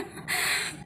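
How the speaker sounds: short quiet chuckle under the breath; a little self-amused laugh; soft laugh. Real, not acted. A vlogger joking to viewers about her kajal, soft chuckle.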